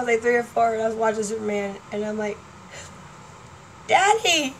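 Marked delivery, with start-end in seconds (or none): 3.9-4.5 put-on high, delighted little-girl cry; excited child. Small girl shouting at the TV, excited. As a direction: call out like a three-year-old spotting her dad.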